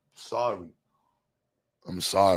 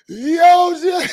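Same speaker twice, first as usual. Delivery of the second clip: laughing; 9 dB louder than usual. Real, not acted. Cracking up at chat.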